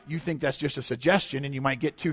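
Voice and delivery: ordinary talk, nothing marked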